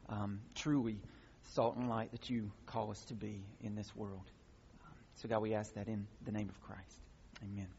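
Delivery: soft, low pastoral prayer; hushed prayer to open the sermon; pastor's prayer. Real, not acted. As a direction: pray quietly and sincerely over the people. Pastor prays before the sermon.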